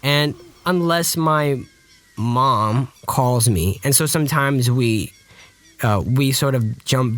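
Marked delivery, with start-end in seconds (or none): none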